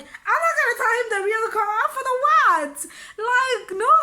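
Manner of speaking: high, loud indignant protest; indignant refusal. Retold or imitated. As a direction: protest as if it's absurd, pitch up. Indignant refusal within the retold story.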